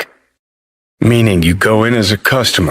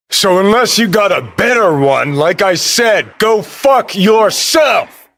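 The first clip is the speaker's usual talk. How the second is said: angry telling-off; 9 semitones higher than usual. Acted. Telling off someone without a better plan, angry shout.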